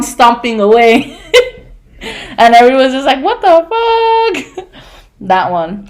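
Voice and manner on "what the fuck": high, very slow stunned exclamation; shocked onlookers. Retold or imitated. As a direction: drag it out in disbelief. Everyone shocked after the outburst.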